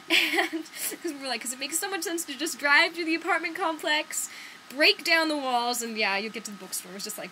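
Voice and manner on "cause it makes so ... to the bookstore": mock sarcasm. Mocking Google's directions.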